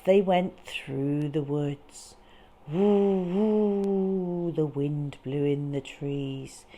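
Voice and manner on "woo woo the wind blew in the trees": plain reading voice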